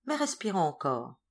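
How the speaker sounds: ordinary delivery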